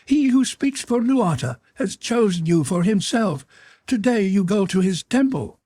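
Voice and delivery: very high, strident monster voice; imperious snapping creature. A narrator's reading. Creature announces the girl's sacred fate to her, imperious. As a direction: proclaim it shrilly, brooking no argument. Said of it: "snapped the Weru".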